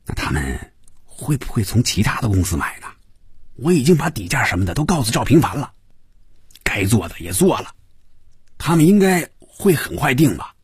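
hesitant start, pushing on toward a hopeful question; tentative probing. A narrator's reading. Fan Yuzhou sounding out his partner about the order, probing. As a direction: feel out the answer with uneasy questions. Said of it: "进一步试探着问".

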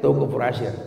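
ordinary talk

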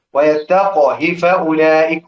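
ويتقه with a silent qaf that bounces in qalqala, slow; correct qalqala. Shown as a demonstration. Teacher demonstrating the correct reading of ويتقه to students, slow, qaf with qalqala. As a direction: say ويتقه as "wa-yattaq-h", qaf stopped with an echoing qalqala. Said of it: "هكذا".

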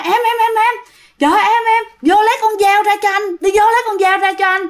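high-pitched mock cry for help; urgent calling out. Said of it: "Anh mới la lên". (giả giọng la gọi gấp gáp, cao vút)

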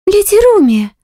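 high, loud startled maid; shocked cry. Acted. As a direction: cry out her name in shock. (потрясённый возглас)

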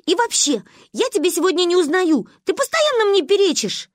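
high, loud, cross retort; angry. A narrator's reading. Girl thinking hard rebukes her friend, angry. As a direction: snap crossly at an interrupting friend. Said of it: "рассердилась Маня".

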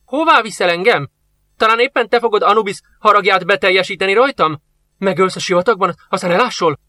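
put-on old master fearing the soldier in the desert; suspicious fear. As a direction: fire off fearful questions.

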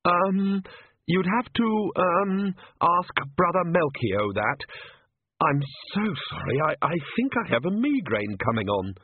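high, hesitant nervous priest voice; timid priest. Acted. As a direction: stammer it out like a frightened cleric.

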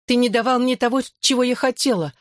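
high-pitched, reproachful complaint; badgering. A narrator's reading. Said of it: "продолжала терзать меня Белла". (терзая упрёками, высоким голосом)

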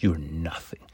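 "You're nothing" said low and soft, dropping away; quiet put-down. Retold or imitated. Reviewer repeats Jennika's line to Lucia, low and quiet. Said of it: "I love it the way she says".

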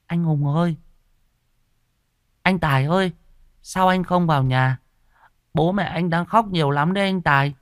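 put-on high-pitched little-boy voice; child asking. A narrator's reading. Boy calls to dead neighbour outside his gate, childlike questioning. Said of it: "nó còn hỏi".

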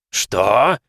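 a bellowed, outraged what; roaring. A narrator's reading. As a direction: roar it like an angry dwarf. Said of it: "взревел Брабер". (рёв)

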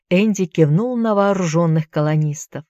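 ordinary delivery, nothing marked

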